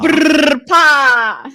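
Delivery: loud rolled r brr pa; Hydra's voice. Pretend. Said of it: "That's better".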